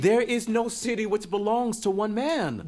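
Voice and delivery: high, loud defiance; heated retort. Acted. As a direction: fire it back at his father. Son arguing back.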